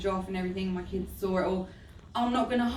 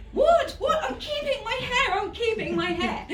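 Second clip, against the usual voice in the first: laughing-crying joy over keeping her hair; elated squeal; 13 semitones higher than usual. Retold or imitated. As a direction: squeal it through happy tears.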